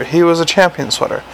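plain reading voice

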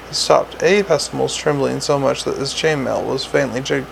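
ordinary delivery

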